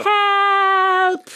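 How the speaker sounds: high, loud cry for help; panicked cry. Acted. Astrid cries for help at the lion, high and loud. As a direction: yelp in panic.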